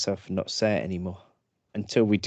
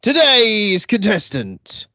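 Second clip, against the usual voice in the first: game show host; 9 semitones higher, 4 dB louder than usual. Acted. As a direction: announce it like a TV host. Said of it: "I sound like an actual game show host".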